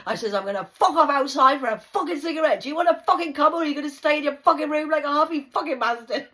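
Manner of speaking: loud, high, swearing back in jest; loud mock retort. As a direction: bellow the teasing challenge through the door. Shouting back at resident.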